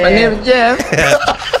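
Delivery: high slow laughing Borat voice; drawn out, giggling Borat line; Borat impression. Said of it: "He sounds like Borat".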